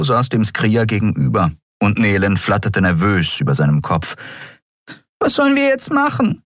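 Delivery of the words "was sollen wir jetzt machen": very high, pleading question; a tiny fairy at her wits' end; desperate fairy. A narrator's reading. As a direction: ask it small, high and lost. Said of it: "fragte sie verzweifelt".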